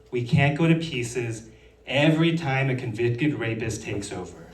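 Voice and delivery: normal talking voice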